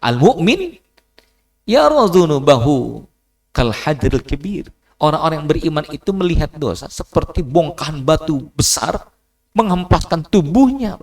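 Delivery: rising sermon fervour; fiery preaching. Acted. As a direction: thunder the verse at them. Preaching at full force.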